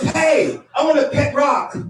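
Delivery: high, loud mock-eager voice; eager friend. Friend announcing wish for a cockatoo, mock-eager. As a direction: announce it with hammed-up enthusiasm.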